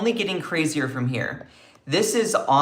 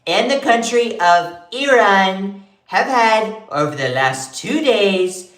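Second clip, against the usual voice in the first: Trump impression; 3 semitones higher than usual. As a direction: puff up and proclaim like Trump. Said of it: "I can't do my Trump impression".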